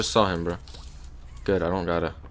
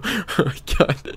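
relieved laugh; 6 semitones higher, slower than usual. Real, not acted. Relieved laugh after chase.

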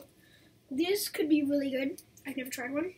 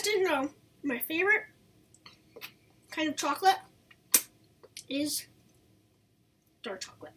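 finger in mouth; talking between finger licks; licking fingers while talking. Real, not acted.

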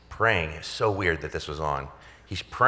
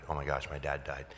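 low, soft retold dread; hushed realization; 3 semitones lower, 10 dB quieter than usual. Son taking the hospital chaplain's call, hushed realization. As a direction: let it sink out quietly, low.

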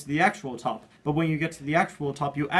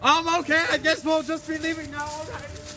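nervous retreat; 13 semitones higher than usual. Pretend.

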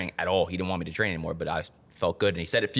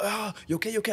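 worried partner; 13 semitones higher, faster than usual. Retold or imitated. Acts partner checking on him.

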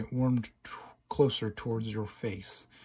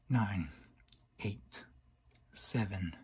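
sinister countdown; 3 semitones lower, 6 dB quieter, slower than usual. Acted. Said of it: "counting down".

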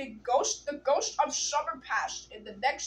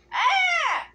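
high loud frightened scream; scream of fear; 9 dB louder than usual. Acted. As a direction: shriek as if about to die. Terrified by the cackling boy.

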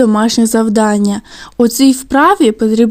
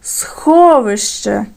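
Сховище very slow, holding the О in СХО; stress on first syllable; much slower than usual. Shown as a demonstration. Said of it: "на який склад падає наголос".

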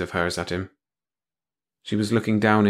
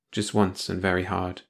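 ordinary delivery

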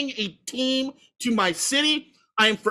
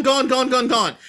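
ordinary talk

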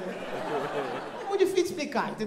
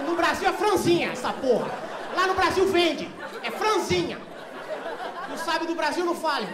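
louder, higher arguing; heated retort; 6 semitones higher, 5 dB louder than usual. Retold or imitated. Arguing with the clerk about Brazil, loud and high. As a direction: fire back defiantly.

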